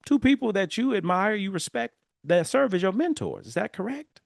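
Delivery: a hint of a gentle guru asking my son; wise future self. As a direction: play the patient wise elder coaxing. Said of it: "future self conversation".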